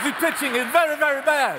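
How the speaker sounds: high, loud mock French accent; hammed-up French accent, shouting the insult; big French accent. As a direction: ham up a French fighter's accent, spitting. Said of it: "big French accent".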